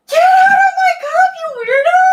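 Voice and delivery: sudden very high, loud yell; shouting. Real, not acted. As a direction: yell at the dog in alarm. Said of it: "that's a little hard on the ears".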